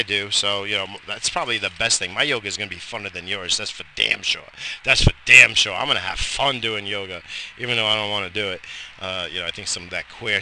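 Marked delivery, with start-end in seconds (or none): 4.8-5.7 louder, emphatic repeat; shouted. Real, not acted.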